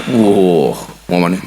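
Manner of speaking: ordinary talk, nothing marked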